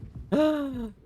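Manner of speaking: sharp high gasp of discovery; sudden delighted gasp; excited gasp. Real, not acted. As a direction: gasp as you spot it. Spots an ID on table.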